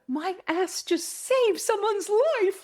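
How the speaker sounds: high, drawn-out mock-dramatic wonder; hammed-up awe, stretched out slowly; over-dramatic. Speaker realizes she saved someone's life, mock-dramatic. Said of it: "it's a bit dramatic".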